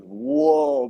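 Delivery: high, loud burst of wonder; a sudden thrilled whoa; excited whoa. Real, not acted.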